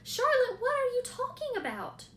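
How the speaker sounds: a frightened pig begging to know; alarmed. A narrator's reading. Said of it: "Wilbur leaped to his feet".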